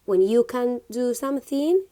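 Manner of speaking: ordinary talk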